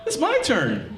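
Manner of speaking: high-pitched plaintive complaint; mock protest. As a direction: protest like you've been cheated, voice way up. Acted protest as she sleeps.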